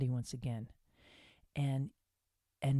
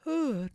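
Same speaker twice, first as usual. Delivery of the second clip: big yawn; 9 semitones higher than usual. Shown as a demonstration. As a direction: let a full yawn out. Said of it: "Another yawn".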